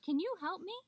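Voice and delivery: high-pitched, urgent plea; pleading Coyote. A narrator's reading. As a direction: beg a friend for help. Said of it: "Coyote called out".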